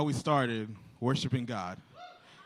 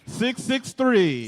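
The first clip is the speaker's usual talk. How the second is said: booming prize announcer; 6 semitones higher, 5 dB louder, slower than usual. Real, not acted. Calling the grand prize winner.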